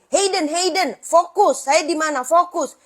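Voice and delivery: put-on high, loud call to grab a drifting kid's attention; calling out firmly. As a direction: snap him back to focus. Calling distracted child back to focus.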